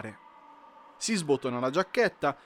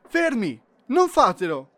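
loud, higher shout; 9 semitones higher, 8 dB louder than usual. Acted. Elf leaps out to stop the penguins, shouting. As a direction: yell to stop them.

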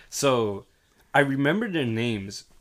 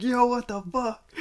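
much higher, slow, drawn-out disbelief; stunned outcry; 13 semitones higher, slower than usual. Retold or imitated. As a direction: squeal it out in shocked disbelief. Snapping coworker about the photo.

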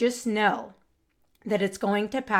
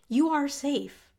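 slow, higher, gentle reassurance; reassuring; 5 semitones higher, slower than usual. Acted. Host reassuring an anxious listener, slow and gentle. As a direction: say it slowly like a calming promise.

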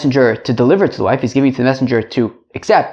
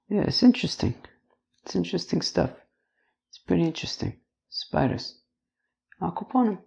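quiet and a bit higher; eerie hush; 5 semitones higher, 11 dB quieter than usual. Acted. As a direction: murmur it like a spooky aside. Mock eerie musing on spiders.

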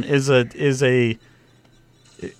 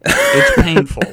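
whiny complaint; 8 dB louder, slower than usual. Real, not acted. Whining about the film.

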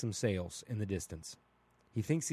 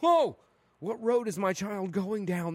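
a sudden high, loud "Whoa!" then a slow worried question; shocked parent; 7 semitones higher, 3 dB louder, slower than usual. Retold or imitated. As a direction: gasp in alarm at your child's news. Parent shocked at child's mistake.

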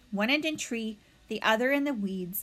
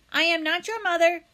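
a hint of a firm robot; flat, even machine voice, raised; 8 semitones higher, 6 dB louder than usual. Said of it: "said the robot".

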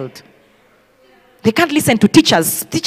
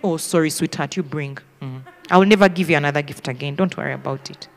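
low, soft, falsely soothing mother; hushed, deep-pitched mock comfort; mock sympathy; 9 semitones lower, 7 dB quieter than usual. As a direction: console sweetly while laying down a penalty. Mother's mock sympathy before confiscating.

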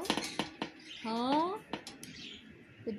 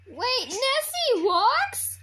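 shocked; 11 semitones higher, 11 dB louder, slower than usual. Real, not acted. Shocked question.

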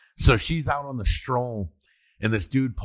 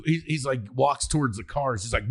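ordinary talk, nothing marked

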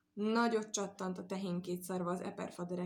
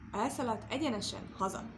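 plain reading voice

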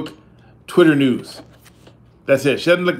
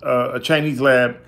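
normal talking voice

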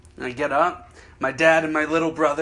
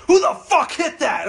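higher, louder slow demand; angry son; 11 semitones higher, 4 dB louder than usual. Retold or imitated. Middle-aged son demanding who hit his father, angry. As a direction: confront the culprit. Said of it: "He's like".

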